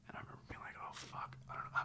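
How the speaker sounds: whispered in fear; terrified whisper. Retold or imitated. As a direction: breathe it out in a whisper.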